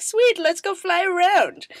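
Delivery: high eager cheer; thrilled to go flying; bright mock excitement; excited agreement. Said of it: "you'd be like".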